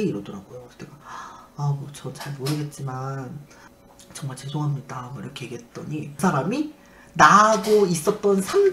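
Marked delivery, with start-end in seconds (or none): 1.6-5.1 meek apology. Retold or imitated. Patient apologizes to the one who cared for her, meekly. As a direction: apologize quietly, pitched down.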